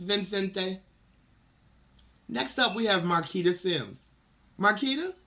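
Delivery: ordinary delivery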